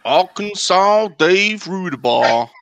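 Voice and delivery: high, drawn-out Arkansas drawl; Arkansas accent. Acted. Showing co-hosts an accent on request, exaggerated Arkansas drawl. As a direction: stretch it into a hammed-up Arkansas twang. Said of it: "I was channeling my Arkansas accent".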